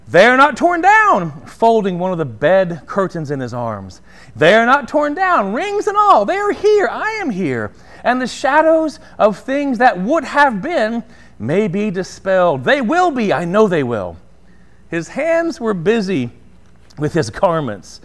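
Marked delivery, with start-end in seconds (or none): 0.1-1.4 a lightly voiced Scrooge crying out in wonder; eager cry. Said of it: "Scrooge cried". 4.3-14.2 excited cry. Acted. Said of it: "Scrooge cried".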